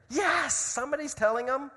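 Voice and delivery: high, loud cheer on "yes". Retold or imitated. Moi listeners glad someone silenced the beggar, cheering. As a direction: cheer like an approving crowd. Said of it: "The Moi cheered".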